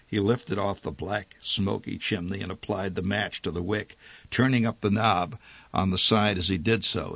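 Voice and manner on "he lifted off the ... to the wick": plain reading voice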